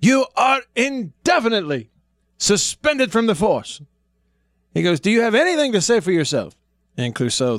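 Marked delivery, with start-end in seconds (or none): 0.0-3.8 higher, stern, retold boss's reprimand; a boss chewing out his inspector; angry commissioner. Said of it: "letting him have it". 5.0-6.5 higher stern demand from the boss; angry commissioner. Retold or imitated. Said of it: "letting him have it".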